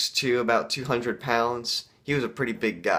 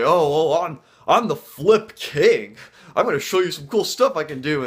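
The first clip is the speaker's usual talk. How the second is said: loud, higher-pitched mock bragging; show-off kid; 5 semitones higher, 6 dB louder than usual. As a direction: brag loudly like the flip king. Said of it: "he'd go out there and say".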